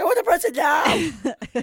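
put-on high childish demand; whiny child demand. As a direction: demand it like a spoilt kid.

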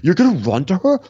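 high, loud incredulous challenge; outraged mock question; raging. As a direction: imitate a man raging in disbelief. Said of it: "he actually rages all the time".

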